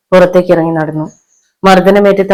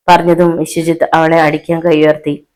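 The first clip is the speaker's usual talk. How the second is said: usual speaking voice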